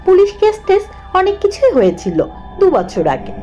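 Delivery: usual speaking voice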